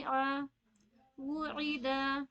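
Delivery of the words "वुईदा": वुईदा stretched very slowly, each vowel held; drawn-out drill word. Shown as a demonstration. A teacher modelling the word for her class, very slow. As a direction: say वुईदा slowly with a clear वु then ई. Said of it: "देखिए तीनों चीजों की मश्क हो जा रही है".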